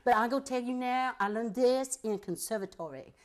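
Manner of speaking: high, loud exaggerated street accent, mocking the casting ask; put-on street accent. To casting people, a loud mocking street accent. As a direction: ham up the accent they asked for. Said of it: "And I'd say".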